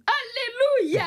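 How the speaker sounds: high, drawn-out hallelujah; mock jubilation. Comedian compared to her fellow humorist, mock jubilant cry. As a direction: sing out in mock praise, pitched high.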